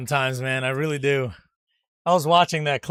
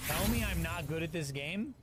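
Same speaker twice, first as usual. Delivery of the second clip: usual speaking voice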